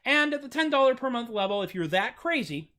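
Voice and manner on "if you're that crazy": ordinary delivery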